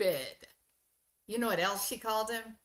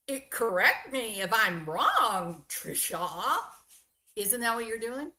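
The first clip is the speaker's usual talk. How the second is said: ordinary talk, nothing marked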